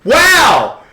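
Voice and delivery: loud, high, stretched exclamation; mock amazement. Reacting to the video's sudden turn, loudly amazed. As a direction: blurt it out big and high.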